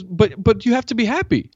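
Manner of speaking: put-on high little-girl voice, insisting; the toddler pleading with dad; daughter's voice. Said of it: "She goes".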